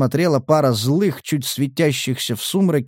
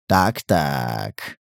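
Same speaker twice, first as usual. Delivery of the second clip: low, menacing drawl; sinister smile; 10 semitones lower, 3 dB quieter than usual. A narrator's reading. Collector strolls up to the prisoner, sinister smile. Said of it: "Недобро улыбаясь".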